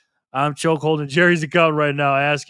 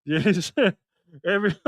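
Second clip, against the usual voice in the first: ordinary talk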